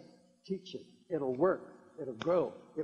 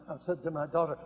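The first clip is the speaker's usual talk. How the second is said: usual speaking voice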